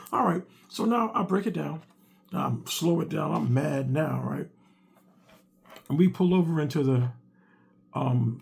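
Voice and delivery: ordinary delivery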